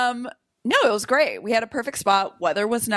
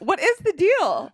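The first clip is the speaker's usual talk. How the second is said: much higher, slow incredulous question; exaggerated disbelief; 10 semitones higher, slower than usual. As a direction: stretch it out in mock outrage. Repeats line, exaggerated second time.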